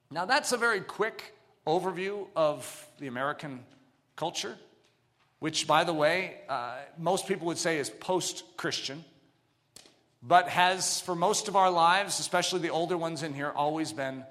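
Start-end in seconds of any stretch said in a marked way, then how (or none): none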